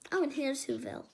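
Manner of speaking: plain reading voice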